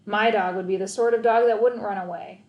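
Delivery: ordinary delivery, nothing marked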